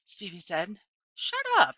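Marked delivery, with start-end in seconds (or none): 1.2-1.7 high, loud snap; sharp retort. A narrator's reading. Opal snapping at the teasing boys, loud and high. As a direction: cut them off sharply. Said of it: "I told them".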